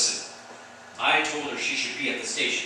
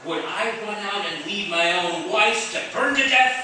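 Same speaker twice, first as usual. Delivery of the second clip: higher, louder outraged denial; indignant plea; 7 semitones higher, 4 dB louder than usual. Acted. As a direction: protest with hurt outrage. Suspect indignantly denies.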